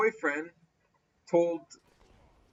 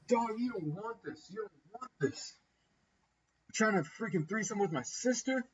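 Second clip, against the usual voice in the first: higher-pitched mock threat, kept soft; retold confrontation; 5 semitones higher, 4 dB quieter than usual. Brother goes up on the tipsy friend, mock confrontational. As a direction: imitate someone squaring up, without shouting. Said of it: "goes up on him".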